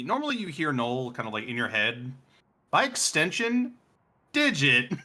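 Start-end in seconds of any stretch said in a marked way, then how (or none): 2.7-4.9 raised, high-pitched call. Pretend. Game master calls from halfway across his apartment, calling out.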